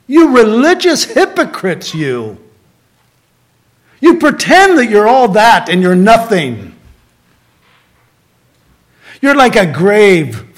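loud, harsh rebuke of the hypocrites; angry rebuke. Retold or imitated. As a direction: rebuke them with rising anger. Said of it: "He's angry with them".

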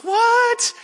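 high, startled "What?"; shocked what. Retold or imitated. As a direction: yelp it in disbelief. Brother stunned by tattoo news.

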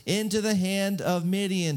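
hammed-up whining complaint; whiny. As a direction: whine in protest like a wronged kid. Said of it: "he kinda gets all whiny".

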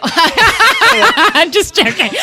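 helpless high laughter through the words; laughing. Real, not acted. Takes back a sex joke to friend, laughing. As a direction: laugh hard while taking back a joke.

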